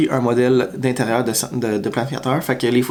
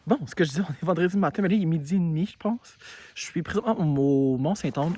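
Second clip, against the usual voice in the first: frozen mouth; 7 dB quieter than usual. Real, not acted. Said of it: "j'ai la bouche gelée".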